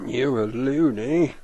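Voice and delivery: high, loud, slow mock copy; imitating the clip. Shown as a demonstration. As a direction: echo the clip line in a silly voice. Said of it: "repeats the clip line".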